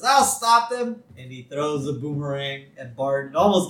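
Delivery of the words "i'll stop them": high, slow proclamation; lightly voiced pursuer; a hint of a brave Aussie chaser; determined guard. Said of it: "One guy says".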